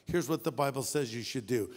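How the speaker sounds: ordinary talk, nothing marked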